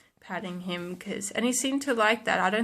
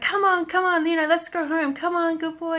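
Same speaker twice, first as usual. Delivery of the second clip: high, bright coaxing call to a dog; hyper; 8 semitones higher than usual. Retold or imitated. Coaxing a lost dog home, higher and hyper. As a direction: pump up the dog, eager and chirpy. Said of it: "really hyper and just be like".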